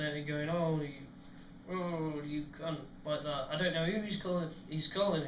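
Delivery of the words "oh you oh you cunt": mock grumpy grumbling, drawn out; grumpy muttering. Steve grumbling alone on the coal pile, mock grumpy. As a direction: imitate a grouchy friend grumbling to himself. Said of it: "grumpy, as, as usual".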